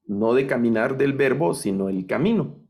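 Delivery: ordinary talk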